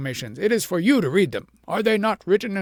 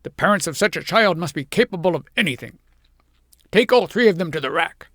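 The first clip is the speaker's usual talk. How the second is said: much louder, higher, commanding; furious shout; 4 semitones higher, 7 dB louder than usual. A narrator's reading. King orders the family to the rack, loud and furious. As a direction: order it in fury. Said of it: "shouted the king".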